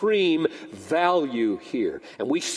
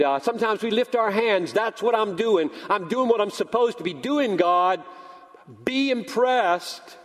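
higher, hammed-up self-satisfied recital; dutiful worshiper; 5 semitones higher than usual. Dutiful churchgoer reporting to God, higher, mock self-important. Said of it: "you're saying to God".

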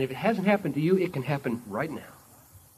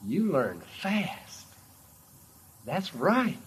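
smiling approval; 3 semitones higher, much slower than usual. Acted. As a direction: smile through the words, gently. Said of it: "Jesus smiles, real big smile".

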